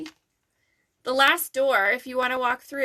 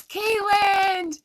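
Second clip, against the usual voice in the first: high, bright shout of the name Caitlin; greeting a viewer by name, delighted; excited call-out; 9 semitones higher, 4 dB louder than usual. Real, not acted. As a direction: call out to Caitlin like spotting a friend.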